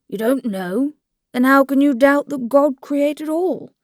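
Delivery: a genial priest who can't quite believe it; good-humoured perplexity. A narrator's reading. Priest puzzled by Levin's answer, good-humoured perplexity. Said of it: "with good-humoured perplexity".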